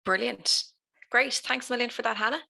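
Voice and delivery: ordinary delivery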